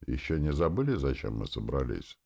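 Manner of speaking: usual speaking voice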